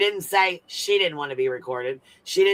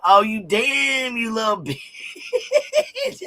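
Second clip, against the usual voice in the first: laughing; 7 semitones higher, much slower than usual. Real, not acted. Laughing reacting to the clip.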